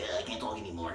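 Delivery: low, very soft mock smoker; cigarette commercial voice. Mimicking a cigarette commercial, low and spent. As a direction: drop low as if the voice is gone. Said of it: "the cigarette commercials".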